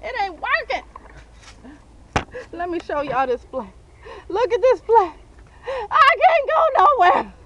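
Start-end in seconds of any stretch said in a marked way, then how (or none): 4.3-7.3 shout. Real, not acted. Stuck, shouting.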